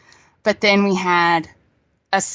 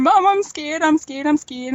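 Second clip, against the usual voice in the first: higher, mildly whiny mock fear; imitated crying child; 7 semitones higher than usual. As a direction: imitate a scared daughter calling for mom. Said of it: "crying and going".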